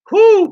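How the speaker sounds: high "whoo" of relish; delighted whoop. Real, not acted. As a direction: whoop over delicious food. Raving about own taco lasagna.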